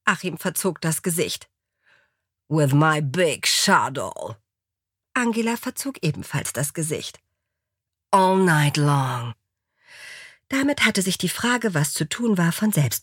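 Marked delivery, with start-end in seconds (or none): 2.5-4.4 slow, boastful low drawl; American lover. A narrator's reading. American boasting to his mistress about his shuttle, slow drawl. 8.1-9.3 very slow, drawn-out promise; American lover. A narrator's reading. American promising his mistress a long night, slow drawl.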